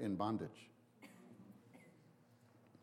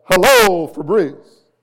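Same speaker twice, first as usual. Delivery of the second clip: much higher, much louder, booming through a theater mask; mask-projected voice; 8 semitones higher, 22 dB louder, slower than usual. Shown as a demonstration. Pastor demonstrates how an ancient theater mask projected the voice, booming. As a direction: blast "Hello" out like a Roman stage mask amplifying it. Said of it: "it would come out as".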